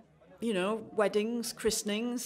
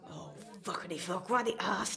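muttered curse; 2 semitones lower, 6 dB quieter than usual. Real, not acted.

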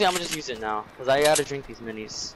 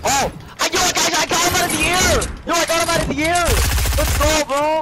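excited shout; 12 semitones higher, 4 dB louder than usual. Real, not acted. Mid-air kill in game.

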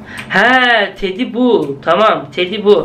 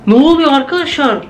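startled excitement; 6 semitones higher than usual. Real, not acted. Creepy toy appears, baby on ceiling.

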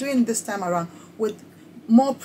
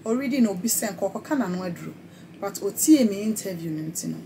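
ordinary talk, nothing marked

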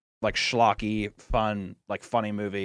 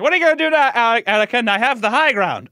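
raised, louder smug Obi-Wan; Obi-Wan impression; 6 semitones higher, 4 dB louder than usual. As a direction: taunt him from the high ground, a bit hammed-up. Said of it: "he's like".

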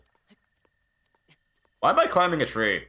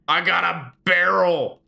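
higher, louder, drawn-out cheer; excited shout; 6 semitones higher, 5 dB louder, slower than usual. Real, not acted. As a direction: crow over your prize, stretching it out. Celebrating an item picked up in game.

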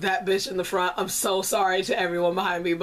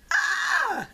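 excited squeal; 12 semitones higher than usual. Retold or imitated. Cheering at concert.

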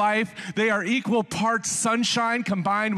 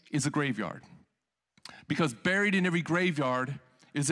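low, hushed, measured recitation; solemn quote reading; 5 semitones lower, 4 dB quieter than usual. A narrator's reading. Preacher quoting a blog on buried dreams, solemn reading. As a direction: let each phrase land gravely, with pauses.

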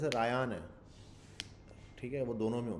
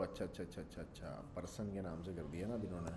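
muttering to himself; 7 semitones lower, 11 dB quieter than usual. Real, not acted. Muttering to self reading code.

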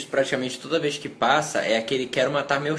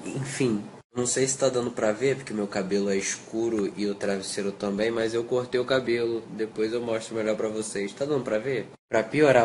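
usual speaking voice

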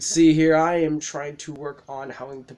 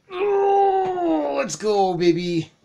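high excited shout of victory; triumphant cheer; 12 semitones higher, much slower than usual. Real, not acted. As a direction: cheer it like a win. Winning moment in fight.

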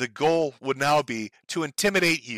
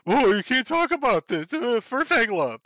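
higher, prissy put-on moderator voice; mocking the chat moderator, pitched up; mock furry mod; 7 semitones higher than usual. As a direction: whine it like an online scold.

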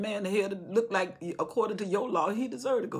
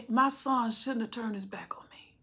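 hushed whisper of a broken father; grieving whisper; 3 semitones higher than usual. Acted. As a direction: whisper the loss, barely voiced. David grieving his son.

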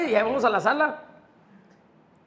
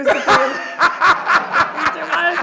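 loud, high guffawing around the words; real laughing; 9 semitones higher, 13 dB louder than usual. Real, not acted. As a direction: crack up loudly at your own story. Se carcajea de su anécdota. (carcajada real, fuerte y aguda)

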